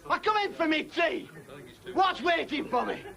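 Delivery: normal talking voice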